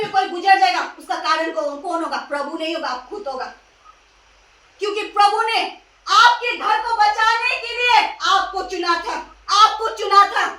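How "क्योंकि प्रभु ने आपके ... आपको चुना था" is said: full-force sermon cry, pressing each phrase; impassioned preaching. Acted. Preacher telling believers why God chose them, impassioned.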